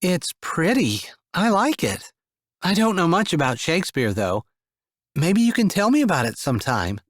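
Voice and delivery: put-on mother calming children in the dark; soft fear-free voice. A narrator's reading. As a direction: keep all the kids calm. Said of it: "keeping her voice soft and completely fear-free".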